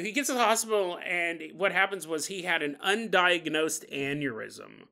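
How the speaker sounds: normal talking voice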